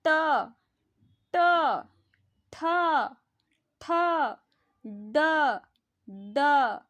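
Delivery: each of ट, ठ, ड said twice, slow and deliberate, with a pause between; teacher showing where the tongue goes; sounding out each letter twice. Shown as a demonstration. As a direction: repeat every letter to feel the tongue. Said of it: "ऐसे बोल के देखो जिह्वा कहाँ जा रहा है".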